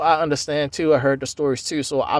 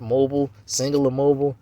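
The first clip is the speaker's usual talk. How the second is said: usual speaking voice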